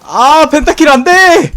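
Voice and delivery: high, loud, drawn-out plea; desperate shout. Real, not acted. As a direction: scream at the screen in despair. Begging to stop the pentakill.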